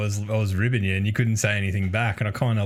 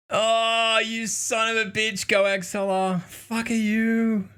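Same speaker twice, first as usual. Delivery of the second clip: high-pitched excited yelling; excited outburst; 10 semitones higher, slower than usual. Real, not acted. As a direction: cheer at the screen. Reacting to a game moment.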